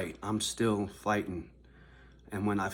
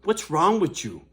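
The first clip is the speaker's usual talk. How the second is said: loud, higher mock caller telling him off; scripted call for fans; 6 semitones higher, 7 dB louder than usual. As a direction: play a fan scolding the manager, loud.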